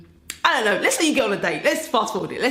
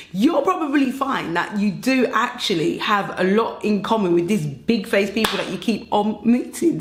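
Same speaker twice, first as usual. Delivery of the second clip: ordinary talk, nothing marked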